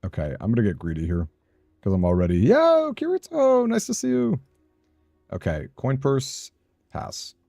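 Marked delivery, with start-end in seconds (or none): 2.4-4.4 much higher, playful cartoon greeting; squeaky greeting. Streamer greeting viewer Kirito in a squeaky high voice.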